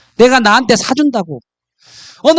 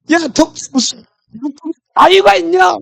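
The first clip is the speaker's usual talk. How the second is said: higher, baffled shopkeeper retort; exasperated pharmacist; 9 semitones higher than usual. Acted. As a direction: throw it back at the rabbit in disbelief.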